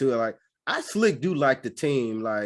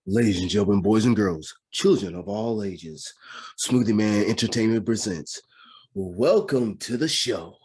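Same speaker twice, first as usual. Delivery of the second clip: ringmaster. Acted. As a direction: call out like a circus ringmaster. Podcast intro announcement, second take.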